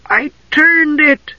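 high, drawn-out kender voice of amazement; slightly amazed. A narrator's reading. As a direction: marvel at it in a high, slow voice. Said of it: "Tass sounded slightly amazed".

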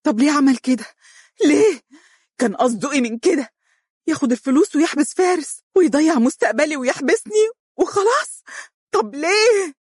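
very high, loud, stunned outrage; shrill angry questioning. Acted. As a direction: demand answers, furious. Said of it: "وهي تقول غاضبة".

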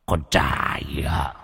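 low, slow villain threat; a low, gloating threat to his prey; menacing villain. Acted. Villain threatens fleeing man.

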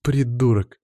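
ordinary delivery

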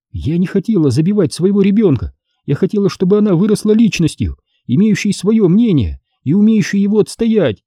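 a touch of hurt indignation, pitched high; mildly heated mother justifying herself; flaring up. A narrator's reading. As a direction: defend yourself, stung. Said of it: "вспыхнула Лариса".

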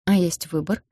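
ordinary delivery

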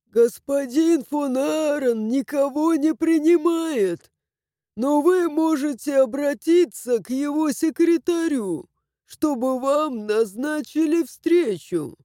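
put-on slow, formal old servant; stiff old servant. A narrator's reading. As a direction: answer coldly like a doorman.

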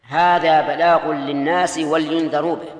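slow recitation with "ولينذروا" as wal-yundharu; deliberate mistaken reading. Shown as a demonstration. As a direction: test them, dropping the i after l in "ولينذروا". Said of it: "صحيحة ولا خطأ".

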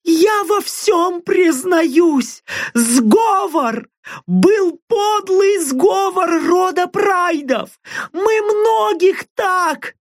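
very high, slow terrified wail; panicked screaming confession. Acted. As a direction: babble it out in terror. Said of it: "заорала". (истеричный крик от ужаса)

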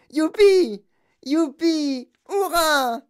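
high, loud squealing cheers; cheering. Acted. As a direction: squeal with delight. Girl cheers wildly.

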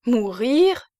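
sharp startled cry, rising high; shocked at the very idea of death; cried out. A narrator's reading. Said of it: "s'écria la vieille fille".

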